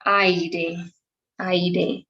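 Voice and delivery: aire with both vowels, a-i-re; two vowels in aire. Shown as a demonstration. Teacher models aire with separate a and i. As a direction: say aire sounding the a and the i apart. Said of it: "focus on the pronunciation of the word aire".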